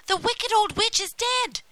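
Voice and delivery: high, loud exultant news; joyful cry. A narrator's reading. Gretel opens the stable, crying out in triumph. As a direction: proclaim it excitedly, pitched right up. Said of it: "she cried".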